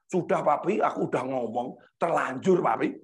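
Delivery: low, sheepish admission to a parent; child confessing. Retold or imitated. Child admitting to father it's already said, low confession. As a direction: imitate a child owning up, pitched low. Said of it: "Dia bilang gini".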